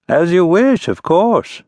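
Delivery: airy, courtly prince; a light touch of the prince's voice. A narrator's reading. Prince dismisses the baron lightly.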